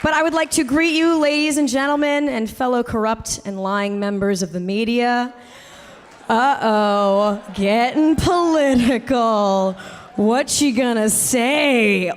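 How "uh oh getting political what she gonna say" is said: slow, drawn-out teasing voice; mock wary audience. Host voices a crowd dreading politics, slow mock suspense. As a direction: play a crowd bracing for politics, dragging every word.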